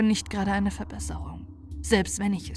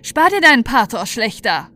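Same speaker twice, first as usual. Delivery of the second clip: scornful snap; 2 semitones higher, 10 dB louder than usual. Acted. Marina scorns the elf lord.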